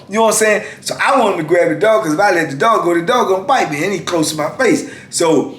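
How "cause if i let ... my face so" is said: ordinary delivery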